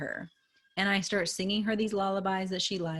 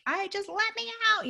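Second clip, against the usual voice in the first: put-on whiny child. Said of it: "is like".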